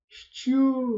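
high, incredulous "що"; disdainful. A narrator's reading. Bardadym hearing of secret business, scornful. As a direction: sneer the question in disbelief. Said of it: "скривився Бардадим".

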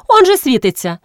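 high insistent cry; mild shout. A narrator's reading. Horpyna showing the girls the lit window, shouting. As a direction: point it out with a lifted voice. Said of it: "крикнула Горпина".

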